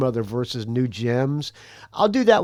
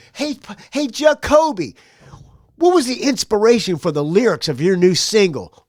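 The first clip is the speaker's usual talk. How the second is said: radio host voice; 5 semitones higher, 6 dB louder than usual. Retold or imitated. Quoting the radio interviewer.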